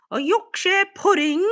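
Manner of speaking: high, put-on British "A Yorkshire pudding?"; mock British accent. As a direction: show off a hammed-up British take on "Yorkshire". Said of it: "trying to talk in a British accent".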